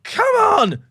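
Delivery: high, loud urging shout; will the ball in, loud and high; excited cheer. Real, not acted. Streamer urging his team on, loud high cheer.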